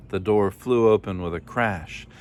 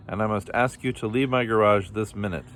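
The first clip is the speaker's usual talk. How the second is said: plain reading voice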